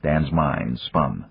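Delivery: ordinary delivery, nothing marked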